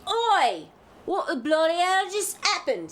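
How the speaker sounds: loud, high Cockney shout of alarm; startled Cockney yell; Cockney accent. Acted. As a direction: bark it out like a London geezer. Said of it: "If that's Cockney".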